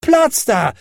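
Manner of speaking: put-on high, loud child's yell; high-pitched, bossy cry; commanding shout. A narrator's reading. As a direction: shout to clear the way. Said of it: "schrie Häwelmann".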